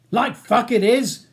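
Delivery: loud, high, drawn-out scorn; angry retort. A narrator's reading. Ronnie's last words to Charles as he goes, scornful. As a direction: fling it back loudly and slowly.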